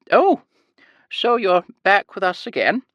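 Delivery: high sing-song Scottish lilt; bright, high, sing-song elderly nurse. A narrator's reading. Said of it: "a heavy Scottish accent and a high-pitched sing-song voice".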